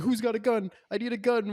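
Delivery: high-pitched mimicry of a needy whiner; mock frantic begging. Co-host mimics the lawyer in the car chase, mock frantic. Mimicking a panicky movie character.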